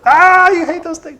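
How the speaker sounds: loud, very high teasing squeal; mock taunting. Dad teasing daughter who hates puppets, mock taunting.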